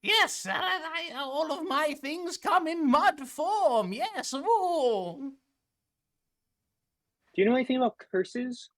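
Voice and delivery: high, sweet shopkeeper voice; mud lady voice. Acted. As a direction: play a sugary old merchant.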